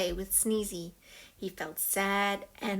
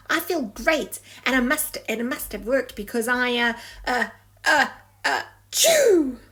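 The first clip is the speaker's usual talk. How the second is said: building into a sneeze; 3 semitones higher, 7 dB louder than usual. Acted.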